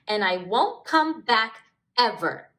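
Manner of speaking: loud defiant outburst; crying out. Acted. Smudge storming out yells at Stripe. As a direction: shout it at Stripe as you storm off. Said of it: "Smudge cried".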